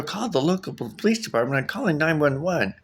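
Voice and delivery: high, indignant repeating back; disbelieving, high-pitched; incredulous. Retold or imitated. Incredulous at dispatcher.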